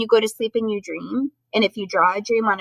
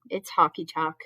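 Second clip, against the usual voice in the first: usual speaking voice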